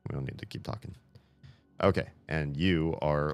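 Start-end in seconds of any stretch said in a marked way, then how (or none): 0.1-0.9 unvoiced whispered aside. Real, not acted. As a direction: whisper it under your breath. Muttering aside to an NPC.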